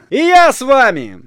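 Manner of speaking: high, loud call after them; ringing long-range shout. A narrator's reading. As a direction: holler to catch them up. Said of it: "крикнул он вдруг".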